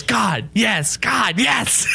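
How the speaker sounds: high, hammed-up exclamations; Steve Carell meme. Adam from the film calls on God, mock meme outburst. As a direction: parody the meme with rising excitement. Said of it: "God, yes" meme".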